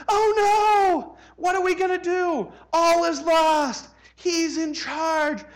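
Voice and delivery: high, slow mock wail of despair; panicked churchgoers. Mocking panicked evangelicals after the election, high wailing. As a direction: wail it like the sky is falling.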